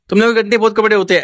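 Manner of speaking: her rude tone. Retold or imitated. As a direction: rattle it off high and quick with contempt. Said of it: "ऐसे बोलती थी ये". (तेज़ और ऊँची, तिरस्कार भरी नकल)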